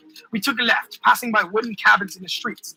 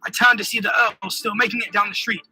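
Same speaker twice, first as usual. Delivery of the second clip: ordinary delivery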